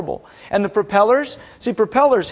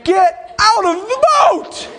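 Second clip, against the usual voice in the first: high, loud, drawn-out shout; hammed-up desperate yell; panicked feet; 14 semitones higher, 5 dB louder, slower than usual. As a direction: bellow an urgent order to flee. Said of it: "My feet were saying".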